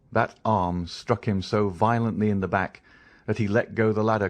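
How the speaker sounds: plain reading voice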